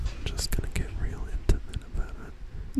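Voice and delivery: close, intimate whisper; ASMR whisper. Pretend. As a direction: whisper right into the mic. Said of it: "Get into some A S M R stuff".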